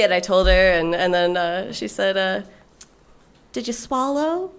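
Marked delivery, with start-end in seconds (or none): 3.5-4.5 lightly voiced friend asking, higher and slow; a hint of a gentle, concerned friend; friend's sweet voice. Best friend asking about the blowjob, mildly imitated sweet. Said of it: "And that's how she talks".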